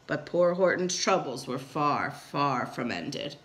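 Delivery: dropping very low and slowing; ominous narration. A narrator's reading. Narrator warning of Horton's coming troubles, ominous narration. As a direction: draw out the warning, sinking down.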